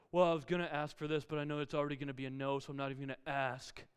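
low, quick resigned grumble; preemptive grumbling. Retold or imitated. Kid repeating the request after dad's pardon, low resigned grumble. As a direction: repeat it clearer but still sulky. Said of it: "it's the preemptive grumbling".

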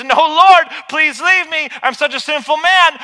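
loud, high pleading confession; pleading Simon. Pretend. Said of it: "He says".

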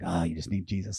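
low, soft private aside; inner thought. Retold or imitated. Pastor reacting inwardly to their problems, hushed inner thought. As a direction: think it under your breath, low.

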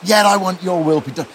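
a rush of thrilled conviction; excited quote. Retold or imitated. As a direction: burst out with hopeful excitement. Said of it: "as he says those words".

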